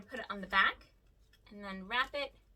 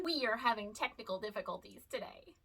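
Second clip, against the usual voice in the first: ordinary talk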